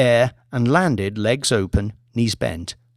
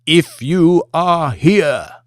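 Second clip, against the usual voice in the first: slow, higher, looming serpent call; snake voice; 5 semitones higher, 5 dB louder, much slower than usual. Acted. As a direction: stretch it out like a summoning. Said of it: "the voice had a trace of Mamadou's, only an octave lower".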